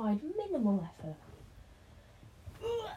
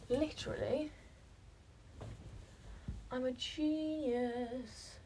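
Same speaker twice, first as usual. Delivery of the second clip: normal talking voice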